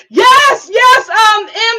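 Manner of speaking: loud, high cry of yes; excited yes. Real, not acted. Streamer reacting to a chat comment, loud excited yes. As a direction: burst out with delight.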